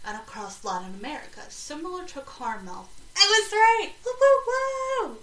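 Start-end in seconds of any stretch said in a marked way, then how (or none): 3.2-5.2 loud, high-pitched triumphant whooping; thrilled. Real, not acted. Host finds out they guessed right, thrilled whooping. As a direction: cheer your own win, voice shooting way up. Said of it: "I get thrilled".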